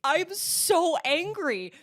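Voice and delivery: high, loud, very slow quote; mock seething outburst. Sobbing friend messaging from the couch, angry outburst. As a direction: stretch it out in imitated anger.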